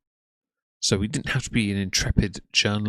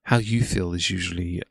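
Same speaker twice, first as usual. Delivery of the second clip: ordinary delivery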